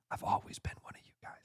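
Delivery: very soft, quick whisper; soft hurried conspiratorial whisper; secret whisper. Pretend. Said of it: "Secretly, between us".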